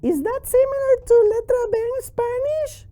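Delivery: very high-pitched puppet question; piping cartoon voice; squeaky puppet. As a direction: ask as a squeaky little character. Puppet Charlie asks the teacher.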